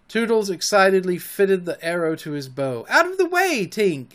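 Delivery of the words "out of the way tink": high-pitched warning shout; raised, urgent boyish yell. A narrator's reading. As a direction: shout her out of the way. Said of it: "he shouted".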